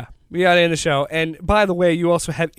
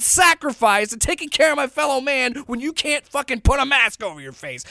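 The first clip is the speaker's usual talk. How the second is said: higher-pitched angry tirade; heated, scolding rant in a raised pitch; yelling rant; 7 semitones higher than usual. Real, not acted. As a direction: yell indignantly at a political opponent. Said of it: "yelling at Glenn Beck".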